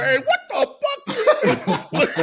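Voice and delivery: slow, high, drawn-out outburst; mock startled. Someone hearing their ringtone, slow high mock shock. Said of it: "they be like".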